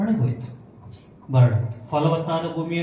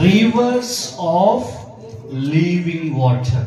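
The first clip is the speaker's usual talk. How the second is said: loud, slow proclamation in broken phrases; crying out; 5 dB louder, much slower than usual. Acted. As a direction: proclaim it forcefully, pausing after "Rivers". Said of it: "Jesus stood and cried out". (ಜೋರಾಗಿ, ಬಿಡಿಸಿ ಸಾರಿ ಹೇಳುವುದು)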